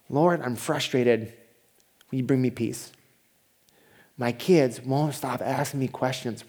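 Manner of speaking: soft, hushed example prayer. Shown as a demonstration. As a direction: pray it quietly and earnestly.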